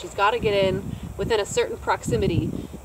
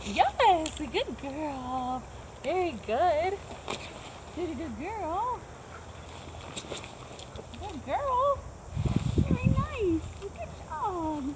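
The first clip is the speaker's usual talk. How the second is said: dog praise voice; 7 semitones higher, slower than usual. Real, not acted. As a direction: coo praise up high to a shy dog. Trainer praising shy dog Lucy.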